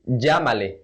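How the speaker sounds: Llámale with LL as a zh; J sound. Shown as a demonstration. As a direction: say Llámale "zhah-ma-leh". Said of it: "with the J pronunciation".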